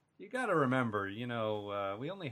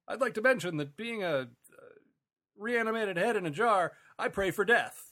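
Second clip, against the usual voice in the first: high-pitched, halting sportscaster impression; Howard Cosell; 9 semitones higher, 3 dB louder than usual. Quoting a cartoon head in a jar, Cosell impression. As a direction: proclaim it like a sports commentator. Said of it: "He did the Howard Cosell".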